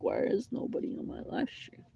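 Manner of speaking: high, airy whispered wondering; mock-baffled inner voice; puzzled thought. Quoting own puzzled thought.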